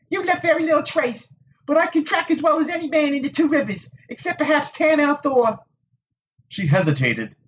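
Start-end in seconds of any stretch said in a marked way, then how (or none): 0.1-5.6 put-on high, tart young woman's voice; prickly village Wisdom. A narrator's reading. Nynaeve to the Aes Sedai, tart and high. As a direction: needle him with a sharp tongue.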